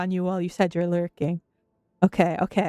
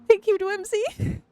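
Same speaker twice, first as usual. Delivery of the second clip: excited thanks; 11 semitones higher, slower than usual. Real, not acted. Thanking gifter.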